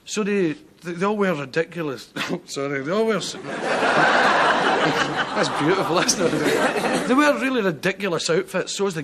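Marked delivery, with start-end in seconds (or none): 3.4-5.3 helpless laughter. Real, not acted. Cracks up mid-sentence.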